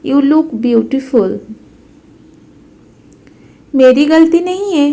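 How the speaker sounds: ordinary talk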